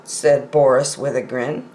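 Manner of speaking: ordinary delivery, nothing marked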